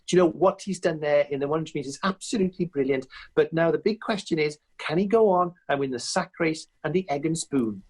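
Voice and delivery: sports commentator voice talking up the sack race; Colin Jackson impression. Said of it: "hear Colin Jackson in the commentary box saying".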